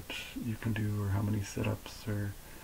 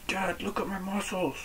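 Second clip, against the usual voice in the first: much higher, child-like mock voice; daughters imitated; 11 semitones higher, 4 dB quieter than usual. Daughters showing off to dad, high imitated child voice. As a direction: pitch it way up like a little daughter. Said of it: "My daughters do that".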